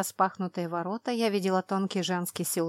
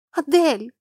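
joyful cry; 7 semitones higher, 8 dB louder than usual. Acted. Sharlin runs up arms open.

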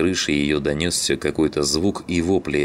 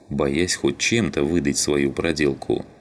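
usual speaking voice

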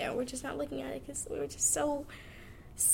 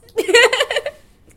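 loud laugh; 13 semitones higher, 18 dB louder than usual. Real, not acted. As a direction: burst of high loud laughter. Laughing at her own joke.

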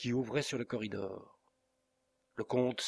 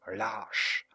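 a sharp, lightly raised cry of contempt; shouted insult. A narrator's reading. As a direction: spit out the insult, understated. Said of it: "cria Montoni". (insulte lancée, un peu plus fort)

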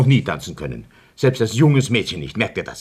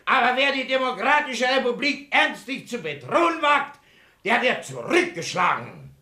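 pompous speech; 8 semitones higher than usual. Acted. Pompous rally speech to members.

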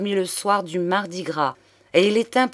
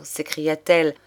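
ordinary delivery, nothing marked

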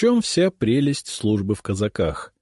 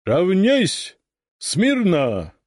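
shouting drill sergeant; 10 semitones higher, much slower than usual. Acted. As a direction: bellow the orders at the ranks. Ataman commands the formation.

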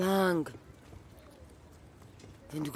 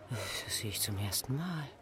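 ordinary talk, nothing marked

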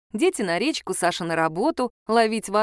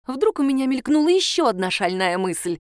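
plain reading voice

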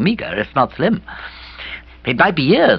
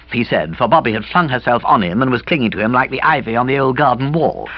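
ordinary delivery, nothing marked

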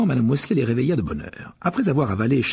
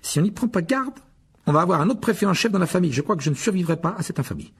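higher, fast mock despair; mock-dramatic George; 7 semitones higher, 3 dB louder than usual. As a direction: ham up the horror. (fausse indignation de George, plus aiguë)

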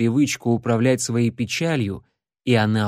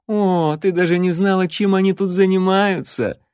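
smirking; 7 semitones higher, 3 dB louder than usual. Acted. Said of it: "Ларри хмыкнул".

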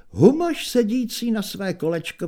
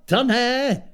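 put-on young minstrel; 6 semitones higher, 5 dB louder than usual. A narrator's reading.

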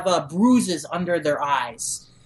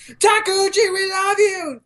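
much higher, louder heroic vow; vengeful battle cry; 14 semitones higher, 5 dB louder than usual. Retold or imitated. Shinsengumi vowing revenge for Takeuchi, heroic shout. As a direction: swear revenge to the heavens. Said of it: "And they're saying".

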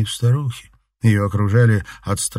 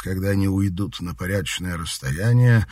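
ordinary delivery

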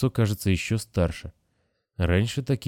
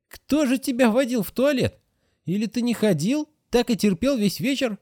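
much higher, fussing nurse voice; grumbling nurse. A narrator's reading. Nurse asks the boy about the toilet, high and fussing. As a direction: fuss over the boy like a strict nurse. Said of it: "Сестра ворчала и хмурилась".

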